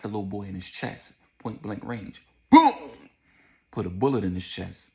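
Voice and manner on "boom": loud, high booming gunshot noise; sudden explosive vocal bang; gunshot imitation. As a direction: boom it out like a gun going off.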